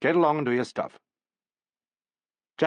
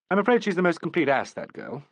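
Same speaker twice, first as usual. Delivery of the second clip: plain reading voice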